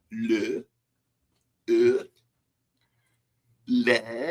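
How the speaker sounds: French accent. Shown as a demonstration. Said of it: "Is that my French accent?".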